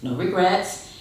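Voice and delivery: usual speaking voice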